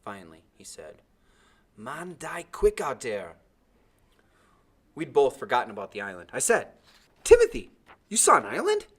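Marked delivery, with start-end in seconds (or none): 1.8-3.4 slow, winded warning; heavy breathing. A narrator's reading. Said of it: "His breathing was still heavy". 7.2-8.9 excited question. A narrator's reading. Boy's eager question.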